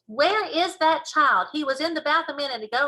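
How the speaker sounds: high, loud fretting mum; exasperated mother. Acted. Mummy looks for her invisible child, high and exasperated. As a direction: call out like a baffled mother.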